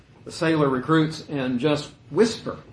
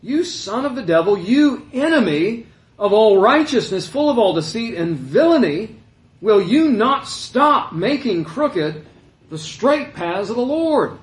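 higher, louder, slow condemnation; a prophet denouncing a sorcerer, halfway yelling; half-yelled rebuke; 7 semitones higher, 7 dB louder than usual. Acted. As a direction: preach it as a fierce rebuke. Paul condemns Elymas.

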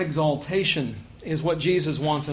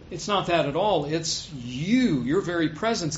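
normal talking voice

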